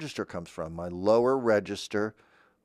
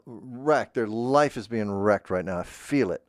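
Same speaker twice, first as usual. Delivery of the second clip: ordinary talk, nothing marked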